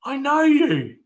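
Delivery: high, loud "I know you!"; excited recognition. Retold or imitated. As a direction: call out like you've spotted someone famous. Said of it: "someone will say".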